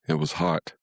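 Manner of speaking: ordinary delivery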